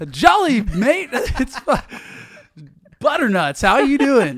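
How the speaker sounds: put-on high, loud, over-the-top American accent; hammed-up American accent. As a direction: ham up a cheesy fifties American accent. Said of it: "I thought you'd bring that American, uh, sensibility".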